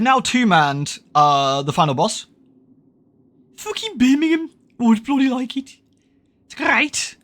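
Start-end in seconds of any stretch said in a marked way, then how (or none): 3.6-7.2 put-on high squeaky Birmingham accent; same high Brummie voice as his dad impression; mock Brummie. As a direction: lampoon a Brummie, pitched way up. Mocking Brummie voice again.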